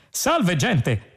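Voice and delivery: an over-cheerful ship's computer bursting in; exuberant computer. A narrator's reading. Computer greeting the crew on the bridge, exuberant. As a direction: burst out with a high-pitched greeting. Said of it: "esplose il computer".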